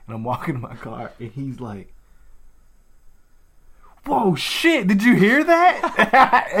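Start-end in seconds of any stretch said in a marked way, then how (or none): 4.0-6.5 high, loud startled outburst with a laugh in it; alarmed exclamation. Retold or imitated. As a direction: imitate a startled man calling out, drawn out. Acting out startled electrician.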